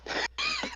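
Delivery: squeaky high laugh breaking out; high burst of laughter. Real, not acted. Friend cracks up at her own tractor joke, squeaky laugh.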